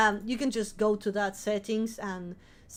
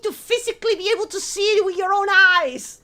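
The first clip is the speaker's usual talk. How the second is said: high, loud, building rant; worked-up insistence, voice raised high; exasperated yell; 7 semitones higher, 6 dB louder, faster than usual. Real, not acted. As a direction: build to a full shout at the end.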